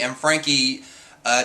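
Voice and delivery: ordinary delivery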